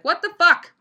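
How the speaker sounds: high, loud exasperated rant; coworker venting. Retold or imitated. Coworker ranting in the back room, loud and exasperated. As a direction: blow up in disbelief at lazy men. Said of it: "she's like".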